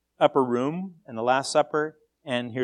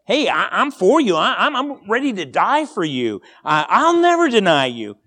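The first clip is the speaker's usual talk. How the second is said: Peter's eager vow; 5 semitones higher, 8 dB louder than usual. Acted. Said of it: "Peter says".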